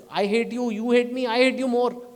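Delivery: loud mock quarrel of bickering relatives; hammed-up shouting match of a family; mock family fight. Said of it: "a rich family doesn't talk like this".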